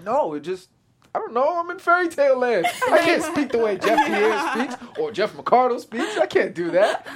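high, loud, laughing excuse; laughing denial to his fiancée; laughing protest. Retold or imitated. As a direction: defend yourself while laughing. Retold defense to fiancée.

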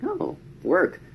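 high, chirpy oh work; forced cheer. Retold or imitated. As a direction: keep the chat alive brightly. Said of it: "I'm like".

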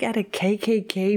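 hushed whisper. Real, not acted. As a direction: drop to a whisper. Describing the Klan scene.

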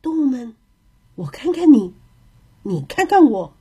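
ordinary delivery, nothing marked

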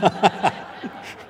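real laugh. Real, not acted. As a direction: laugh at your own joke. Laughs at own bus-driver joke.

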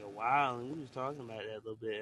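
ordinary talk

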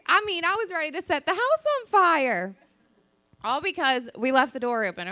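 ordinary talk, nothing marked

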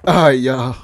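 ordinary talk

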